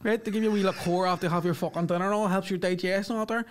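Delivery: lightly voiced Belfast lad; spidey Belfast lad. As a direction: imitate a Belfast passenger telling his mate. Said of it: "I hear one of them going".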